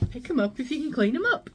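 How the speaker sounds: saying her own motto; catchphrase. Real, not acted. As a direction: pronounce the motto with a lift. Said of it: "But I always say".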